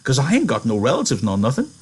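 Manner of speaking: high, quick, excited pitch; eager interruption. A narrator's reading. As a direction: cut in eagerly, rushing to persuade. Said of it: "Candy interrupted him".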